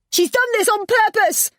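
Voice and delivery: very high, loud yell; yelling after him. Acted. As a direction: shout it down the stairs. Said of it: "I yelled after him".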